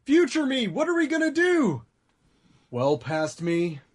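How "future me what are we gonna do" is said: high anxious voice. Acted. Talking to future self.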